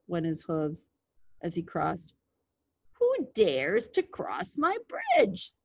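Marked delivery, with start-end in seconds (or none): 2.9-5.5 slow, drawn-out troll challenge with big pitch swoops; stretched-out troll growl, rising high. A narrator's reading. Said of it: "growled the troll".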